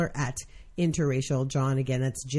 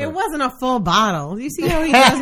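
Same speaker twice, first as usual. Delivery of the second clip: higher, louder mock outrage; indignant protest; 8 semitones higher, 7 dB louder than usual. Real, not acted. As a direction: protest playfully to the guest. Defensive protest to guest.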